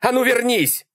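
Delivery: high-pitched urgent shout; high, exasperated command; yelled order. A narrator's reading. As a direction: yell for someone to come back. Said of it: "снова заорал Малькольм".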